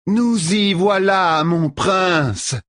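shouting. Acted. Soulane calls to Matt over the wind, high and slow. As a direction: call it out proudly. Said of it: "cria Soulane".

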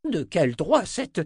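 loud, high, drawn-out protest; indignant outburst. A narrator's reading. Old wizard challenges the seer, outraged. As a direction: protest angrily, breaking off mid-sentence.

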